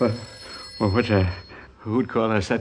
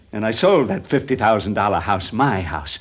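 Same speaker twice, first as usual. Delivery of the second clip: ordinary talk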